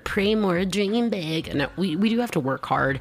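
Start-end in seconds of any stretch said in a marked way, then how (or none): 0.0-1.5 slow, sarcastic motivational tone. Acted. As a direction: say it like a mocking pep talk. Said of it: "I was trying to do my sarcastic voice".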